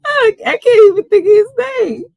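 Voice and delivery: high, fast words through tipsy laughter; can barely get it out for giggling; laughing fit. Real, not acted. Tipsy host on live stream, forgetting a rapper's name, laughing.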